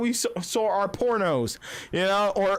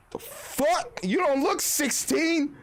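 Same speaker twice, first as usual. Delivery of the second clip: shocked disbelief; 6 semitones higher, slower than usual. Retold or imitated. Guy voiced shocked.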